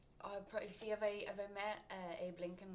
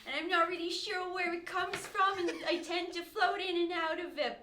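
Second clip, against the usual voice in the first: put-on higher New York accent; Jersey accent, louder and higher; 7 semitones higher, 5 dB louder than usual. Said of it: "can you do a New York slash North New Jersey accent?".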